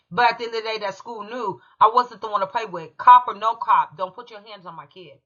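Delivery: ordinary delivery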